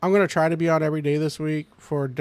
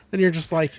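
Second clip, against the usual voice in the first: ordinary delivery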